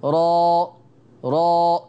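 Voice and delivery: را pronounced thick and open; heavy ra. Shown as a demonstration. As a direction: demo را with a full, thick r. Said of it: "قولوا كده معايا".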